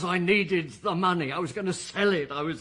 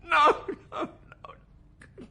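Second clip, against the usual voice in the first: breaking down; 11 semitones higher than usual. Acted. Suspect breaking under pressure.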